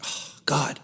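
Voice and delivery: hushed disappointed whisper; whispered sigh. Retold or imitated. Man recalling another no-show, sighing in a whisper. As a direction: breathe it out, let down.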